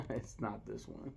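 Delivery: ordinary delivery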